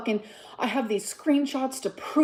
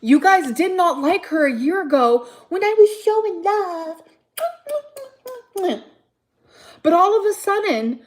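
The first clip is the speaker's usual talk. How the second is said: higher and louder, strained; heated complaint; 6 semitones higher, 3 dB louder than usual. Real, not acted. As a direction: throw the accusation at viewers.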